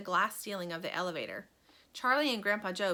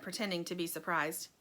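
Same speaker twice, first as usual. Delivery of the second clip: plain reading voice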